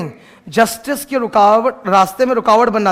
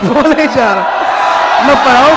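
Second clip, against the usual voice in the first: exasperated shout; 4 dB louder than usual. Real, not acted. Teacher snaps at noisy class.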